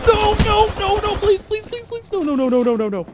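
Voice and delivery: high frantic pleading; frantic begging. Real, not acted. Gamer chased down, begging frantically to survive. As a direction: plead desperately, voice climbing high. Desperate while being chased.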